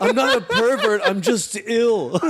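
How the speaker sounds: laughing. Pretend. Mock fetish video line.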